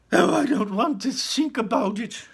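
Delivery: high, loud dismay; high loud distressed; upset mother. Acted. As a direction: recoil from the thought.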